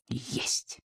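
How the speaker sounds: very soft, voiceless whisper; hushed echo of a cry. A narrator's reading. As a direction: breathe it out barely audibly.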